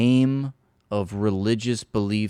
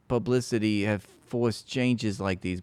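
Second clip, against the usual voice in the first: ordinary talk, nothing marked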